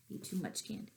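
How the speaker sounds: usual speaking voice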